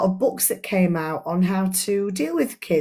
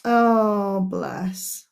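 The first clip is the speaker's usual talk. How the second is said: tender aside. Real, not acted.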